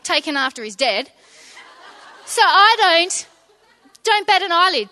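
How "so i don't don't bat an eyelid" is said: laughing. Real, not acted. Laughing at the joke.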